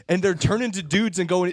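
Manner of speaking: normal talking voice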